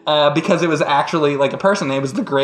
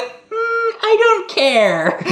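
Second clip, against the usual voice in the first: indifferent witch; much slower than usual. Acted.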